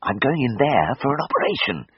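a cross, scratchy retort; rasped crossly. A narrator's reading. Patient snapping back at the anaesthetist, cross rasp. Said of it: "I rasped crossly".

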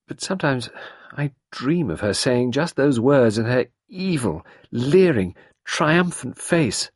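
put-on a haunted young woman; young woman trembling at the memory; Sarah, shaken. A narrator's reading. Sarah's dialogue voice, frightened.

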